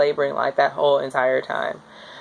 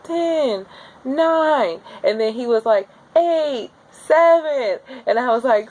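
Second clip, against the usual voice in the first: strained countdown; 9 semitones higher than usual. Retold or imitated. Couple counting down together through the worst of a contraction, high and strained.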